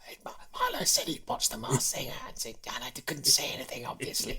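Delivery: hushed secretive whisper. Retold or imitated. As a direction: whisper it so no one hears. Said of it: "Linda had said to me".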